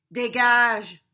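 very loud, curt dismissal; gruff crocodile. Acted. Crocodile tells the little bird off, loud and gruff. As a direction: roar it to chase off a pest.

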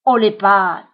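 loud, menacing order through the teeth; icy, forceful hold-up command; terrible ice-cold voice. A narrator's reading. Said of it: "d'une voix terrible, froide comme la glace, il grince entre ses dents".

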